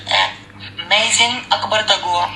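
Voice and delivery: normal talking voice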